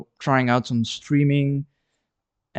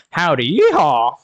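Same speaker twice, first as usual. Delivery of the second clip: hammed-up Texas cowboy howdy, yeehaw; a playful Texan yeehaw, loud and high; mock Texas accent; 4 semitones higher, 7 dB louder than usual. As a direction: fake cowboy twang, on purpose badly done. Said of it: "my horrible American accent".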